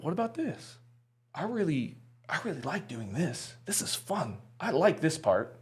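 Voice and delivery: small, high, tentative inner voice; hushed wondering. Retold or imitated. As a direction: try the idea out quietly, almost privately. Retelling a quiet inner thought.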